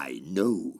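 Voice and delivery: low menacing reply. Acted. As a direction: answer as a threatening father. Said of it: "You were very menacing".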